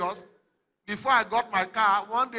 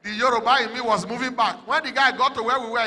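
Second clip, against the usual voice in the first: ordinary delivery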